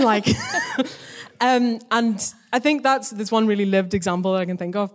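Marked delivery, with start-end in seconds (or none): 0.3-1.4 laughing. Real, not acted. Laughs after retelling manager.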